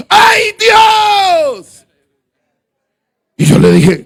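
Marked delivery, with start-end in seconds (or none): 0.1-1.7 high, tearful cry to God. Retold or imitated. New convert on the road at night, crying out to God.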